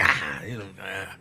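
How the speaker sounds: a low, mumbled mock reply; lightly voiced sheepish brush-off; mock dodge.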